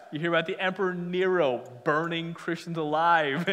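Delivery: usual speaking voice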